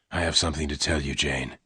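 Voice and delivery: calm her quietly; low soft gunman. Acted. Lassiter confides.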